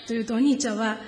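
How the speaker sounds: ordinary delivery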